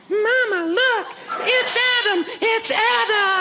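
put-on high, drawn-out child voice; little kid. Little kids to mama at the shore, high childish calling. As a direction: squeal it slowly like a small child pointing someone out. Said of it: "Little kids are going".

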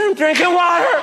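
put-on innocent child calling back; little girl voice. Child answering from bathroom.

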